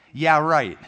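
dry, emphatic scoff; sarcastic disbelief. Acted. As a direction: dismiss an empty promise with sarcasm. Mocks empty gym promise.

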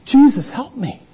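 pleading beggar. Retold or imitated. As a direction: beg like a blind man at the roadside. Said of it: "this guy's like".

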